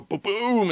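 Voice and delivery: stuttered lead-in to a high, falling boom; high "boom" sound effect, sliding down; explosion boom. Pretend. Imitates blast heard from inside.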